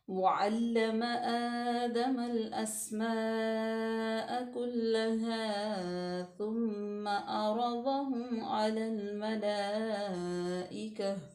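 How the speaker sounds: slow melodic tilawat; Quranic recitation. Acted. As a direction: chant the verse slowly and reverently. Recites the verse.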